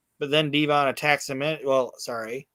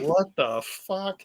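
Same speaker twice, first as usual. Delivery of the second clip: slow drawn-out baffled question, pitched up; baffled what; 6 semitones higher, much slower than usual. Retold or imitated. Retelling Rocky Four, the character looks around baffled, slow and high. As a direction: stretch it out in mock disbelief. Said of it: "he's just like".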